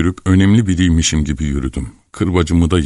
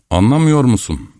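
higher-pitched, mildly raised voice; mild shout; 7 semitones higher than usual. A narrator's reading. Team leader leaning toward the mother, mildly shouting. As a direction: call it out as a restrained narrated shout. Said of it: "diye bağırdı".

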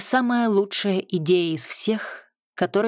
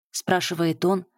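plain reading voice

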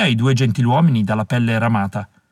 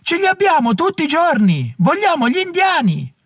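very high, strained mob voice; heckler shout. A narrator's reading. Heckler jeering at the chorus leader, very high mob voice. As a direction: jeer back at the stage.

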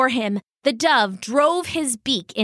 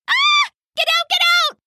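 very high, shouted mouse shriek; panicked cry; 4 dB louder, faster than usual. A narrator's reading. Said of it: "cried the little mouse".

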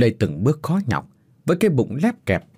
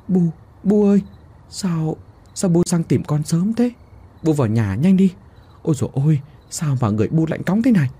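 higher, urgent stammer with halting repeats; stammering alarm; 6 semitones higher than usual. A narrator's reading. Daughter-in-law greeting her cold mother-in-law at night, stammering alarm. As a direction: fluster over the frozen old woman. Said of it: "và lắp bắp".

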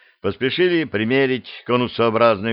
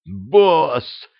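frantic, raised call of boss; flustered devil; 4 semitones higher than usual. Acted. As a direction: blurt boss out in a flustered rush. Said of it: "суматошный голос чёрта".

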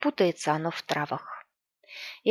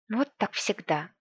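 soft, sulky grumble; grumbling; 4 dB quieter than usual. A narrator's reading. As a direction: grumble it to yourself. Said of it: "проворчала Оленька".

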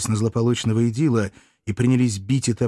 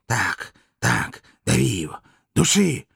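rasped, spent urging in short bursts; hoarse wheezing. A narrator's reading. Dictator gloats over the slaughter, wheezing hoarsely. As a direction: croak the orders with savage relish. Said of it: "продолжал хрипеть".

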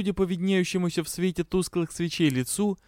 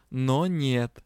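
ordinary delivery, nothing marked

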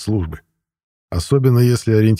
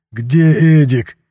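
slow, higher, stretched-out cry; drawn-out exclamation; 5 semitones higher, 4 dB louder, much slower than usual. A narrator's reading. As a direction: stretch the question out in exasperation. Desperate thought during the struggle. (протяжный возглас, голос выше)

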